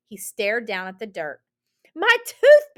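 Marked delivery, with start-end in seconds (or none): 1.9-2.7 loud, high whoop of excitement; Benny whooping. Acted. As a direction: whoop it out, thrilled by the find. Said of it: "Benny whooped".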